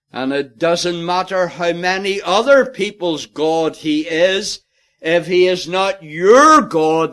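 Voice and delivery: loud, fervent sermon delivery; preaching at full force. Real, not acted. Preacher pressing home the point, loud and fervent. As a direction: drive it home to the congregation.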